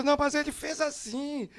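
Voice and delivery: high, insistent mock panic; panicked protest. Husband insisting to wife, high and panicked.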